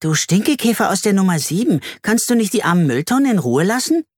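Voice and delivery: girl answers the boy from the balcony, lightly voiced cheeky retort. A narrator's reading. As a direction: throw the insult right back at him. Girl snaps back at neighbour.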